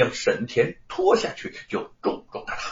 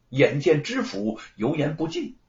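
ordinary delivery

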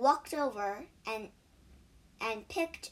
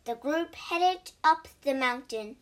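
ordinary delivery, nothing marked

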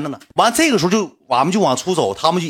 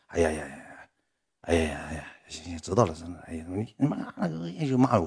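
low, soft muttered acknowledgement; quietly fed up, voice dropped low; dismissive brush-off; 7 semitones lower, 9 dB quieter than usual. Retold or imitated. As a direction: wave off an apology under your breath. Brushing off an apology.

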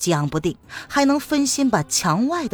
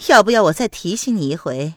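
higher, louder, sneering taunts with scoffs; mocking woman; 4 semitones higher, 4 dB louder than usual. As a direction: needle her rival with spite. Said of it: "玉兰子居然也在笑". (尖刻嘲讽的女声)

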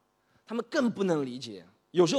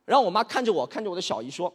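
usual speaking voice

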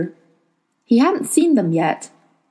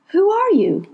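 put-on boy voice; 10 semitones higher than usual. A narrator's reading. Will questions old man.

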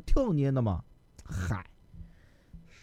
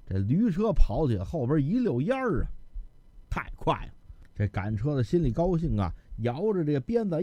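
ordinary delivery

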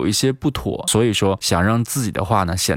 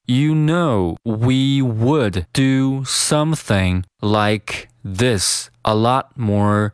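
word by word, very slowly; careful, spaced-out teaching pace; slow model reading; much slower than usual. Shown as a demonstration. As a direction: read it out slowly for learners. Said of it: "我们先来读一下".

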